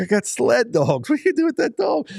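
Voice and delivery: high, stretched-out mock outrage at a toy dog; retold disbelief. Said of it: "And I'm like".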